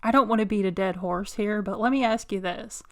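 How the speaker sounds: ordinary talk, nothing marked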